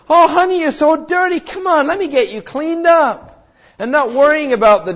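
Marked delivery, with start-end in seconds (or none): 0.1-3.3 put-on high, fond cooing to a muddy child; doting mother. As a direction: coo over the dirty child like a loving mom. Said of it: "the mother of that child says".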